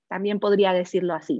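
usual speaking voice

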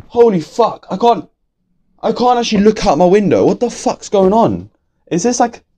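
alarmed by the wind outside; real alarm. Real, not acted.

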